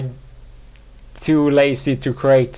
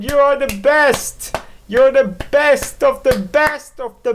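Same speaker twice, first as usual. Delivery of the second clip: self-cheering; 10 semitones higher, slower than usual. Pretend. Podcaster cheering himself on, very high and gushing. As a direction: cheer yourself on like a fan crowd. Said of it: "I'm like".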